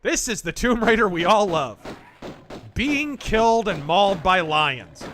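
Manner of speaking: loud ironic repeat; sarcastic. Real, not acted. Ironic repeat after lion attack.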